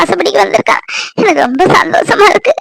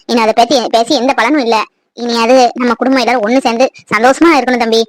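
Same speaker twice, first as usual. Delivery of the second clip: normal talking voice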